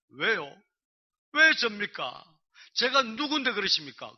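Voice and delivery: loud, high pleading questions; acted protest. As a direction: cry out in disbelief at being chosen. Acting out protest to God.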